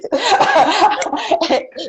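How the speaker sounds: real laugh. Real, not acted. Laughing at viewer comment.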